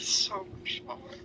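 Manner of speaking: a soft breathy hush over the blood; hushed awe. A narrator's reading. Deity savoring the blood, hushed awe. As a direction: let the words fall away in quiet fascination. Outsider voiced, awed whisper.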